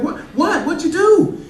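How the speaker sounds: high, eager mock excitement; excited dad. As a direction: jump in with mock delight. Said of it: "I was like".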